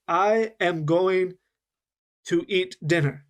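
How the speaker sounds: very slow, every sound said separately; "I am going to eat dinner" spoken word by word, slowly; slow and careful. Shown as a demonstration. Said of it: "It's very slow, and I'm saying every sound".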